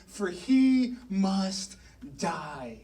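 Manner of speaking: very slow, dropping on the last word; grave pronouncement. Retold or imitated. King condemning David to death, slow and grave.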